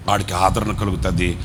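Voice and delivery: usual speaking voice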